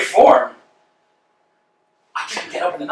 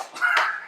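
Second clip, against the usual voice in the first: sharp laugh; 10 dB quieter than usual. Acted. Vladimir's outburst.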